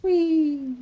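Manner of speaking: high playful whee; gleeful whee. Real, not acted. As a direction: squeal with delight on the ride. Riding a booster in game.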